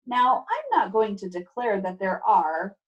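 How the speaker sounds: usual speaking voice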